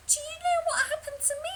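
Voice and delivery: high, fast, breathless hen; frantic chicken voice. Acted. Chicken Licken to Lucky Ducky, high frantic panic. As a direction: squawk it out in a panic.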